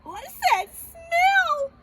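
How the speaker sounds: high, loud, drawn-out disgust; Baby Bear. Acted. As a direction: whine it slowly like a revolted cub. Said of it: "Baby Bear gagged".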